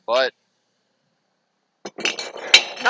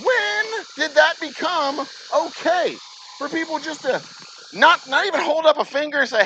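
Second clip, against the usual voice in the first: exasperated rant; 11 semitones higher than usual. Real, not acted. Venting to viewers about a rude customer, outraged high rant.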